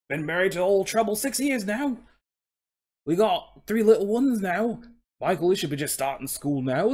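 put-on Cockney horse voice; Cockney coach horse. Horse recalling his dad's lessons, Cockney accent. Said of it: "he's speaking in a Cockney accent".

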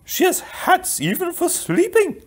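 very high-pitched queen; queen's voice. Acted. Queen excusing the princess to her son, high-pitched voice.

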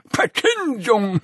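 slow high grandpa voice; Opa Jimmy. Acted. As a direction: savor the word like a wise old man. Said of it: "hatte Jimmy gesagt".

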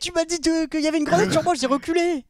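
high, loud indignant complaint; outraged protest. Real, not acted. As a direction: defend yourself, fuming. Arguing over grenade death. (protestation indignée)

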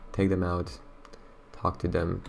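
usual speaking voice

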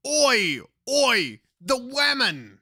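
cartoonish ork voice, half joking; mock ork voice. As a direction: play a thick ork cheering for the women. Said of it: "They should be happy, like".

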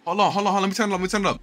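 high, loud, urgent rush of hype; excited. Real, not acted. Gamer stopping to turn it up, excited. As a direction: blurt it out, repeating yourself loudly. Excited after the winning play.

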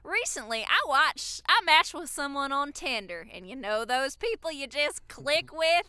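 Southern accent. Shown as a demonstration. Reading a fan's Tinder backstory, hammed-up Southern accent. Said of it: "read it in a Southern accent".

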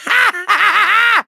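long, high villain cackle; evil cackle. Acted. Villain gloats over a trick up his sleeve, maniacal laugh. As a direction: cackle triumphantly, drawn right out.

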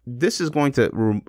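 usual speaking voice

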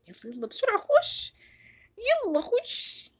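high, loud urging; urgent hurry. Real, not acted. Gamer urging his character into the house, high and loud.

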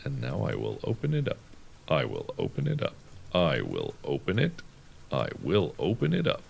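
low, soft little chant; hushed self-narration. Pretend. Player opening the toy chest, hushed repetitive murmur. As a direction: say it softly to yourself, low.

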